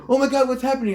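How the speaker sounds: high, startled panic; alarmed. Retold or imitated. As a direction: jump at the noise outside the house. Kareem panics at the scream.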